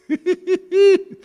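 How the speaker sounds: sudden loud high laugh; shrieking laugh; high burst of laughter. Real, not acted. Laugh after child's line.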